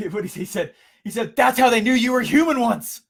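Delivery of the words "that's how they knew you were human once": loud, high-pitched protest; emphatic outburst. Retold or imitated. Boss to colleague deleting episodes, loudly emphatic. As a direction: drive the point home at raised pitch. Boss's retold outburst, restarted.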